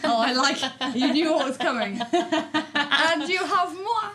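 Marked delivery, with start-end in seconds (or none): 2.1-4.1 a drawn-out, hammed-up French "moi"; slow, playful mock French; mock French accent. Host introducing herself after the French sister, teasing French accent. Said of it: "If you're gonna do accents".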